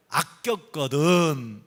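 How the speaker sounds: very slow, falling scripture cadence; drawn-out verse ending. Acted. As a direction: stretch each syllable of 아꼈거든 and let it fall. Ends the verse being read aloud.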